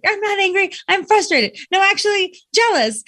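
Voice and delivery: high-pitched mock-indignant protest; imitated angry daughter. Daughter names her feelings to mom, mock-angry. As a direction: play a daughter insistently correcting herself. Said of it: "she's like".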